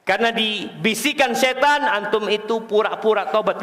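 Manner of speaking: ordinary talk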